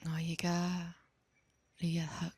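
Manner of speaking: usual speaking voice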